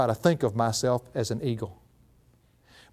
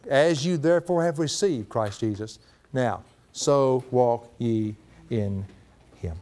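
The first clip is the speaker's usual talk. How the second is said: scripture reading; 3 semitones lower, slower than usual. Acted. Quoting scripture solemnly.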